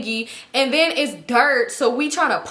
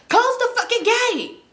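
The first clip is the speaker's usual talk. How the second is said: high, slow, drawn-out bossy call; mock yelled order; 11 semitones higher, slower than usual. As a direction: call after them like a fed-up homeowner. Homeowner yells after them.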